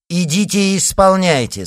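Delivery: loud, high order; imperious command. A narrator's reading. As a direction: command it with no argument. Queen overrides protest with an order.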